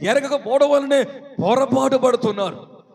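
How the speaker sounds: forceful, driving sermon delivery; urgent pulpit exhortation; full-force preaching. Real, not acted. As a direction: preach it out to the congregation.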